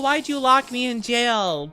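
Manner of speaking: ordinary talk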